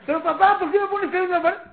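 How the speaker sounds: loud, high whining objection; child's angry protest. Acted. Angry boy to father about the nails, loud protest. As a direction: protest loudly like a cross child.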